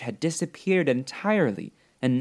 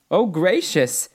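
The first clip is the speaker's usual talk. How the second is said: higher, louder exclamation of surprise; startled cry; 6 semitones higher, 5 dB louder, slower than usual. A narrator's reading. Dorothy learns she is meeting a witch, exclaiming. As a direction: exclaim in girlish astonishment. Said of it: "cried Dorothy".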